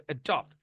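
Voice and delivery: Adapt stressed on DAPT, falling; short A, weight on DAPT in adapt; syllable stress. Shown as a demonstration. Teacher repeating the example, adapt with second-syllable stress. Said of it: "এই যে এ, এখানে আছে".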